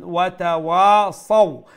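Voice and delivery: slow, loud model of "watawasaw"; lean letter demonstration. Shown as a demonstration. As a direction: sound out "watawasaw", gliding into a short final "saw". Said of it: "with a soft voice and with a quick voice".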